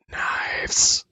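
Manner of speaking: raspy whispered voice of the dead; rasping corpse. Acted. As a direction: rasp it out in a whisper. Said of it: "it'll rasp out like".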